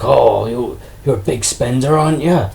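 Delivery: imitated sarcastic trader, lifting at the end; mock-impressed jibe with a rising tag. Trader teasing a customer buying only two, mocking. Trader mocks a small purchase, retold.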